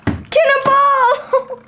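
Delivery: high, drawn-out yell; cannonball yell. Acted. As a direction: shout it as you leap in. Boy jumps into hot tub.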